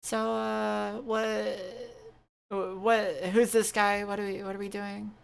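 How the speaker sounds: high, flustered questions; Mary, confused. Acted. Mary confronting the stranger, high confused voice. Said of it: "she looks over at you and she's like".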